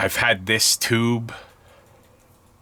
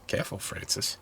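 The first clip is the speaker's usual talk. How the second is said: whispered self-warning; 11 dB quieter than usual. Real, not acted. Scolding himself after a slip.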